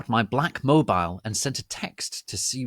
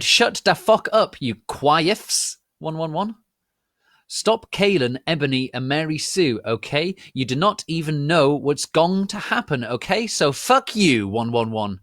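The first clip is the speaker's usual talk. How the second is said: angry rant; 7 semitones higher, 6 dB louder than usual. Acted. Ranting author's note.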